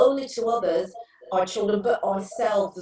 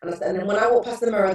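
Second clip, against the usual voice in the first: usual speaking voice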